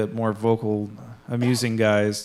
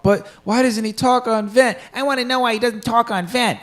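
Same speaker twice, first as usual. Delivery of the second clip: much higher imitated whiny child; pestering kid; 10 semitones higher than usual. Obnoxious small child asking about silent guildmate, pestering. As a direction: pitch it up and nag like a kid.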